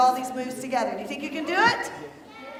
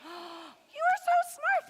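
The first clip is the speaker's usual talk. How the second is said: high, slow gasp of pretend amazement; hammed-up wonder for little ones; mock amazed gasp; 11 semitones higher, 4 dB quieter, much slower than usual. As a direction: gasp as if the kids stunned you. Praising kids' right answer.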